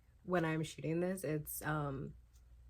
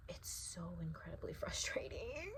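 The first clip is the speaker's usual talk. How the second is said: laughing whisper; 7 dB quieter than usual. Real, not acted. Frustrated reading reaction.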